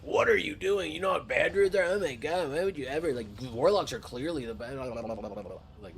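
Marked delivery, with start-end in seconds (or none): 0.0-4.8 high, scolding put-on voice; mock outrage, pitched up; mock flamer. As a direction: imitate a toxic player's tirade. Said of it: "we're not like".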